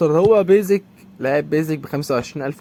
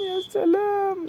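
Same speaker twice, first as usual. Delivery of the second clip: "يا سلام" swooped very high and slow, then falling; mock-marvel with a soaring squeak on "سلام"; sarcastic falsetto; 6 dB quieter, much slower than usual. Real, not acted. Sarcastic reaction to conceded goal. ("يا سلام" بصوت رفيع جدا وممطوط بسخرية)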